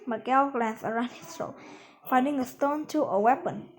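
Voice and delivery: ordinary delivery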